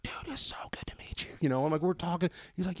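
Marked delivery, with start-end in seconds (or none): 0.0-1.3 hushed, voiceless gush; starstruck whisper. Retold or imitated. Fan meeting Colton Wong, starstruck whisper.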